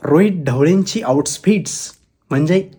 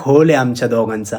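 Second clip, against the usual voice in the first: usual speaking voice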